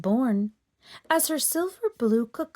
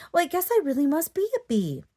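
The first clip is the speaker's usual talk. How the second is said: fast, bright little voice; quick hopeful chatter; cheery; 7 semitones higher, much faster than usual. Acted. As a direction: rattle it off, pleased. Horse cheerfully decides.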